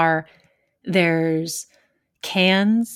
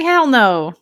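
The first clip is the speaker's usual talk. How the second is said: emphatic refusal; 6 semitones higher, 5 dB louder than usual. Retold or imitated. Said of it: "I'm like".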